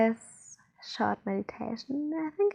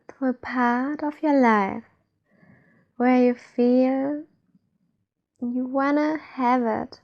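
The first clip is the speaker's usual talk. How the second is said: guided meditation. Acted. Guide leads listeners to feel trust inside, guided meditation. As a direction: ease the listener inward, unhurried.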